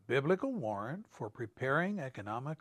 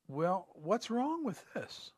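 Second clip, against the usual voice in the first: puzzled question; 4 semitones higher, 7 dB quieter, slower than usual. Retold or imitated. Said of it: "We read that and we ask".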